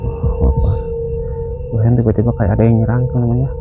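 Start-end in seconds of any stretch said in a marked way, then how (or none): 0.0-0.8 nervous night vlog whisper. Real, not acted. Startled during night ghost hunt.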